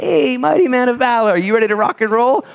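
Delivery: God hailing Gideon with big enthusiasm; excited God. Acted. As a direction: hype him up like a coach. Preacher voicing God greeting Gideon.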